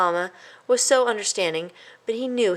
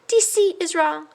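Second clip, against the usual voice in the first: high, solemn little voice; guilty mouse; 11 semitones higher, 4 dB louder than usual. A narrator's reading. A guilty mouse solemnly confesses, high. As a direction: say it like a lesson.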